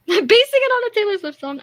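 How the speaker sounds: loud, high, laughing; amused outburst. Real, not acted. Friend delighted by a [name] reference, laughing outburst. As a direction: burst out high through laughter.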